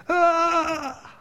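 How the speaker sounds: sudden high panicked yelp; panicked cry. Acted. Saturday night panic before Sunday school lesson, high yelp. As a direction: shriek like a teacher in a panic.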